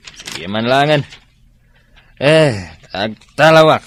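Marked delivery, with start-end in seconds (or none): 2.2-3.8 louder exasperated announcement; loud exit. Acted. Fed-up friend loudly saying he is leaving. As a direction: throw it out as you leave.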